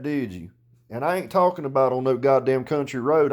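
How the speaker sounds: ordinary delivery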